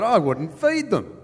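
each word slow and loud, high; loud slow put-down. Retold or imitated. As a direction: lean on it so it carries down the bank. Her voice carried.

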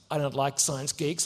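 low, sneering schoolboy bully. Retold or imitated. Bully hits the boy, sneering low. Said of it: "then he hits me and goes".

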